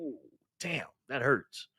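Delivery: slow, softened mock hurt; retold wounded reaction. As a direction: take the blow quietly and slowly.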